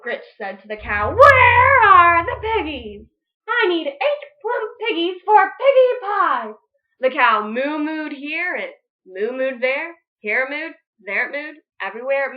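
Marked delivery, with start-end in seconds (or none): 1.2-6.5 loud witch demand. Acted. Witch Grinch demanding pigs from the cow, loud.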